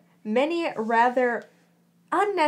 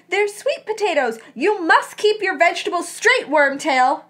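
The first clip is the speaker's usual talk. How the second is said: high, loud scolding; theatrical shouted lecture, emphatic; indignant yell; 6 semitones higher, 6 dB louder than usual. A narrator's reading. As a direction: tell him off at top pitch. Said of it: "he yelled".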